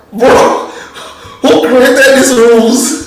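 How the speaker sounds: laughing, slow mock outrage; amused exasperation at the rules; chuckling who created these rules; laughing disbelief. Real, not acted. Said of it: "Why would let".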